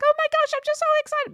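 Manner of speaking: very high, fast, loud gushing; excited squeal. Retold or imitated. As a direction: imitate someone bubbling over, an octave up. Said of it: "their voice goes up an octave".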